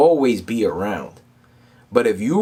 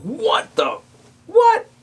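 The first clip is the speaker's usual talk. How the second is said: high incredulous outburst; acted-out shock, squeaking up on the last word; stunned disbelief; 4 dB louder, much slower than usual. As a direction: let it jump up high and loud. Retells shock at huge theft.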